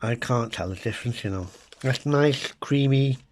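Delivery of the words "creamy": ordinary talk, nothing marked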